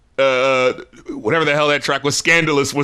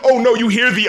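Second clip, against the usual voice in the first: ordinary talk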